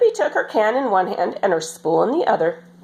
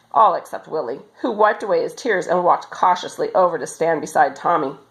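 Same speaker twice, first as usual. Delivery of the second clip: usual speaking voice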